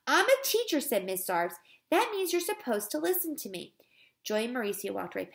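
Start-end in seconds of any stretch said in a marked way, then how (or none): 0.1-0.8 high, loud assertion of authority; indignant. A narrator's reading. Teacher to children who ignore her, indignant. 1.9-3.7 high-pitched, exasperated teacher; indignant scolding. A narrator's reading. Teacher to children who won't listen, indignant scolding.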